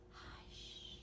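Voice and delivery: a whispered hush, very soft. Shown as a demonstration. Showing the child how to whisper hush. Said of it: "Can you whisper hush?".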